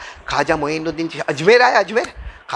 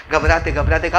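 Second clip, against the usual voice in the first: usual speaking voice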